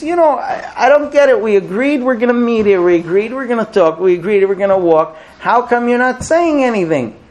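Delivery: high, loud exasperated husband; retold quarrel, pitch way up; frustrated outburst. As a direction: hammer out each complaint in acted exasperation. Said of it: "He's frustrated".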